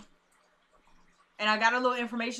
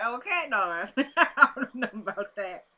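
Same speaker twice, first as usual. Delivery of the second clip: laughing disbelief; 2 semitones higher, 5 dB louder than usual. Real, not acted. Laughing pushback at his line.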